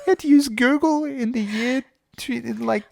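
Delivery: very high, squeaky mocking voice; hammed-up squeal of fake shock; mock incredulity. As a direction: feign appalled disbelief, breaking off as you go. Mocking the interviewee's Google excuse.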